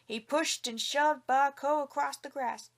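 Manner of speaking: usual speaking voice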